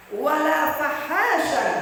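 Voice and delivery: drawn-out recited Arabic; slow chanted hadith line; slow Arabic recitation. Retold or imitated. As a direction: stretch each syllable as in recitation. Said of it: "Anas bin Malik radhiyallahu anhu berkata".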